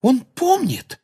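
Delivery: put-on very high, halting girl's cry; high-pitched astonishment; amazed exclamation. A narrator's reading. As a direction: gasp out a discovery in a high voice. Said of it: "воскликнула Гермиона".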